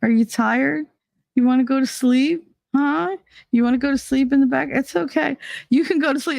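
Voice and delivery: high-pitched, gentle parent talk; imitated coaxing father. Father telling little kid it's okay to sleep, imitated coaxing. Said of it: "the father's like".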